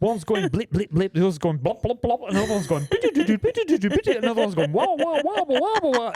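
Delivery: blips and wahs, rising high and laughing; imitated instrument noises. Producer mimics a messy sixty-channel session, playful noises. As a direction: sing out silly synth sounds.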